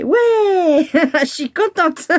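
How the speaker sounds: cri de joie aigu puis rire; fière d'avoir réussi, ça éclate. Real, not acted. Petite victoire du bricolage réussi. (joyeuse et aiguë, en riant)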